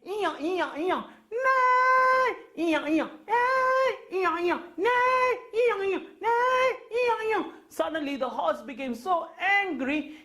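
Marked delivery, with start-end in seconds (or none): 0.1-7.4 high loud bray and neigh back and forth. Acted. Donkey and horse squabbling, high braying and neighing. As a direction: trade brays and neighs like a quarrel. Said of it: "And donkey cried".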